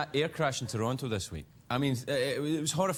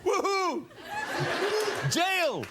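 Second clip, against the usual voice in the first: put-on drunken whoop; much slower than usual. Drunk partying cheer.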